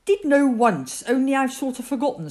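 lightly careless admission. A narrator's reading. Christopher Robin admits forgetting to Rabbit, mildly careless. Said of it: "said Christopher Robin carelessly".

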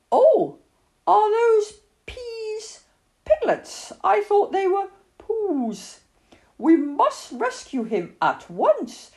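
put-on high, urgent child character; Christopher Robin. A narrator's reading.

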